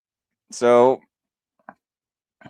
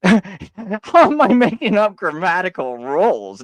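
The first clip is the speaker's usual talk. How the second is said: laughing disbelief; 6 semitones higher, 8 dB louder than usual. Real, not acted. Mocking his opponent's claim, laughing loudly. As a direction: laugh through it, higher and louder.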